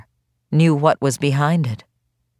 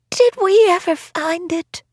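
put-on feverish old woman voice.

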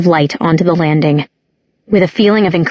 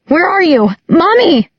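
panicked shriek; 11 semitones higher than usual. A narrator's reading. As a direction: scream for a lost mother. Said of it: "she shrieked".

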